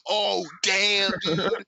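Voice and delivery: hammed-up impressed reaction; mock amazement. Acted stunned reaction to force push.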